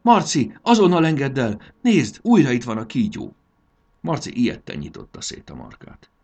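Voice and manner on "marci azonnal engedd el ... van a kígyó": high, loud alarmed command; urgent warning shout; shouted order. A narrator's reading. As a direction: yell at someone to let go at once. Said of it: "Fanni rákiáltott".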